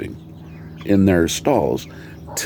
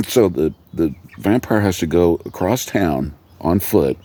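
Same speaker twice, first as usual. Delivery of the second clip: normal talking voice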